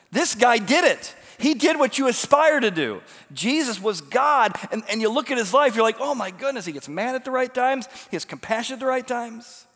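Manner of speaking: high, loud, driving sermon delivery; fired-up preaching. Acted.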